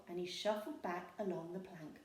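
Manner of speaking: plain reading voice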